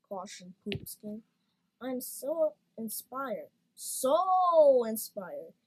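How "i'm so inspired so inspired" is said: slow, swelling, much louder gush of inspiration; enthused. A narrator's reading. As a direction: stretch it out, bursting with inspiration. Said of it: "enthused the red crutch".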